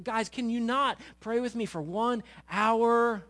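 slow, high, loud plea; exasperated reproach. Acted. Jesus finds disciples asleep again, exasperated reproach. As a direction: stretch it out in exasperated disbelief.

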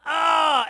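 loud, high cry of frustration; a mock outburst at your own failure; frustrated yell. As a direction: let out a fed-up yell. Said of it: "and you're like".

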